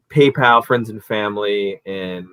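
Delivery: ordinary talk, nothing marked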